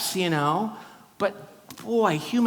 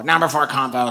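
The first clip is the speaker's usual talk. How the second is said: louder, curt counter call; bored counter call; 6 dB louder than usual. Retold or imitated. Airport cashier calling his order, loud and bored. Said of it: "the lady was like".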